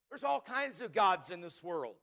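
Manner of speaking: normal talking voice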